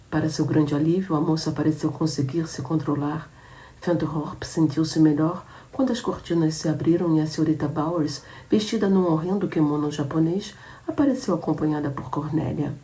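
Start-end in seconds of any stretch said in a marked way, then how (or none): none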